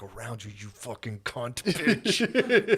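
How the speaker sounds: comic swearing tirade, breaking into laughter; retold inner cursing. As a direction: curse under it, then squeal the insult. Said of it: "in my head, I was like".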